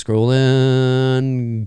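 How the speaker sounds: stretched, held on one level note; idle sing-song stretch; drawn-out word. Real, not acted. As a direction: let the word hang while searching. Drawn-out filler while scrolling.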